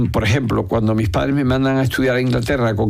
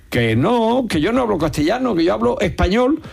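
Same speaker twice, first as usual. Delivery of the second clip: higher-pitched, insistent retort; re-enacted indignant correction, pitched up; acted insistent protest; 8 semitones higher than usual. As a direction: protest as if correcting a phone operator. Acts out indignant reply to operator.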